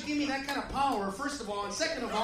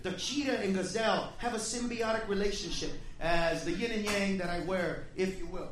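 mock sage lecture; 6 semitones lower, 6 dB quieter than usual. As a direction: play the calm sage explaining nature. Mock wise nature lecture.

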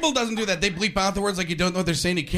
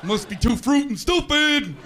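mock dismay; 5 semitones higher, slower than usual. Mocking viewer, slow.

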